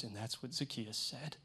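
hushed, overly concerned story reader; imitated earnest concern. Wife following the script for the kids, imitated earnest concern. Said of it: "Look concerned at the kids".

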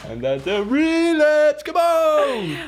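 high-pitched mock plea; squeaky whine. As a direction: whine it like a bratty kid begging. Repeats the joke, cranking it up.

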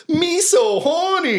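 very high, slow squeaky soundbite; soundbite impression. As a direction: squeal it slowly like a sample. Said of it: "And I said".

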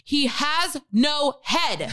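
slow, exasperated emphasis; mock-angry reply to a doubting operator; mad. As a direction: spell it out slowly, word by word. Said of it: "I was mad".

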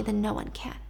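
hopeless breathy whisper. Acted. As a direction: let it die away in a whisper. Holly's despairing plea to Seth.

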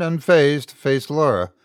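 plain reading voice

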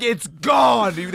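loud high yell of the brother; retold shout. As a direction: belt it out as the exasperated brother.

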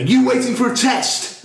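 high-pitched, over-keen mock helper; overenthusiastic helper. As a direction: imitate an eager official pouncing on a patient. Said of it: "he was so enthusiastic".

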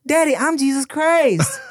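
put-on high, earnest little-boy voice; a kid proudly answering his dad; innocent child voice. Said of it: "And he was like".

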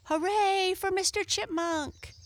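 high cheering shout. A narrator's reading.

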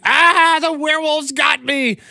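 high mock scream of terror; retold scream. Mom pranking her son in the laundry room, mock terrified scream. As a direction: shriek it like a prank scare. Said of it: "she starts screaming".

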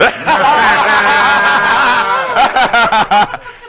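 loud burst of laughing; loud laughter. Real, not acted. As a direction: crack up loudly. Big laugh at joke.

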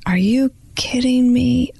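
slow, drawn-out retelling of her stunned reply, near tears; stunned disbelief. Retold or imitated. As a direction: let the disbelief stretch out, voice close to breaking. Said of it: "And I was like".

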